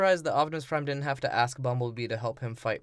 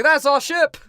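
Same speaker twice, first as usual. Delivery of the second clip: high, loud hammed-up yell; mock shout; 12 semitones higher, 10 dB louder than usual. Friend acts out a movie character, high loud mock yell. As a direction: belt it like a cartoon hero.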